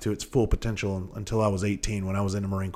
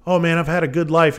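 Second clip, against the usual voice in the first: smug self-satisfaction; 8 semitones higher, 5 dB louder than usual. Retold or imitated. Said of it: "when you think".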